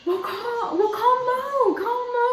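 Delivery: high, loud imitation of flustered urging. Mother telling daughter to call the husband, imitated flustered urging.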